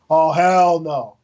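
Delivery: drawn-out mild mock holler; mock yell. Retelling some dude in the theater, slow mock yell. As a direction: imitate a moviegoer's yell, very slowly, without full volume. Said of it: "I heard some dude yell out".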